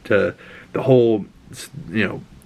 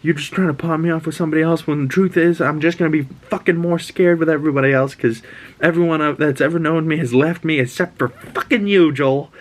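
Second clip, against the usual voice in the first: put-on high-pitched teen girl snapping back; mock teenage outburst, higher; angry Ellie; 7 semitones higher than usual. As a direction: pitch it way up and spit it out. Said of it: "she's like".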